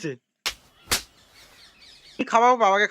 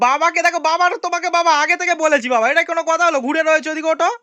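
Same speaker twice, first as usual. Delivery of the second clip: teasing scold; 8 semitones higher, 5 dB louder, faster than usual. Real, not acted. Son scolds dad for not joining.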